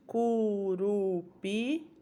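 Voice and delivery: "Curupi" drawn out very slowly; very slow blending. Shown as a demonstration. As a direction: stretch "Curupi" while blending the sounds. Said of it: "blending syllables". ("Curupi" bem arrastado)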